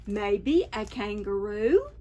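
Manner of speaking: ordinary delivery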